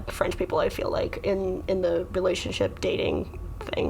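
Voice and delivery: hushed whisper; whispered gossip. Real, not acted. Comedian whispering gossip about dating in France. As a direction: lean in and whisper it.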